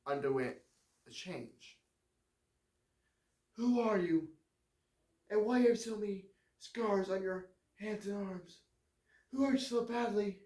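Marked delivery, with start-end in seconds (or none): 3.6-10.4 higher, lighter woman's voice; frail woman. Acted. Dying warden's wife asks John about his scars, high and gentle. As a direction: ask it gently, a sick woman wondering.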